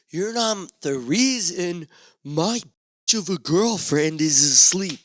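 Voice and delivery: slow, high, then loud sneer; a hint of a bitter alter ego; angry second personality. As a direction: let the nasty alter ego slip out. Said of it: "There's two personalities".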